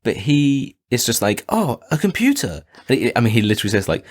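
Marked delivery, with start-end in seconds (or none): 1.5-2.6 put-on high-pitched curious kid; acted wide-eyed discovery; mock wonder. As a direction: pitch it up, delighted by the find. Voicing kid discovering a computer.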